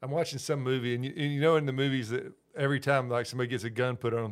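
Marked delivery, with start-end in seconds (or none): none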